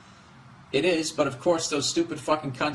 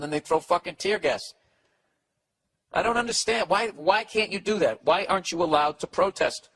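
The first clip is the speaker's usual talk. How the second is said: normal talking voice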